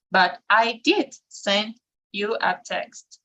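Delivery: slow model sentence with a strong "did"; emphatic "did", measured rhythm for learners; stress on DID. Shown as a demonstration. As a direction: punch "did", then space out the rest. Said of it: "it is always stressed".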